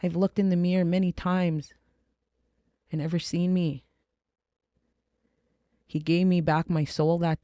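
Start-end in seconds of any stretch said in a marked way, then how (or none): none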